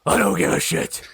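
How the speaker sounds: over-the-top gruff growl; Batman. Acted. Batman answering Gordon's complaint, in a gruff growl. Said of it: "he had, like, a relatively gruff voice".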